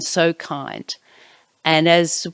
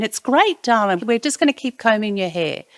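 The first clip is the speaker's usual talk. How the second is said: put-on much higher, sing-song reassuring to a child; bright reassurance; 6 semitones higher than usual. Aunt to a pleading niece, higher sing-song reassurance. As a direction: cover the worry with cheer.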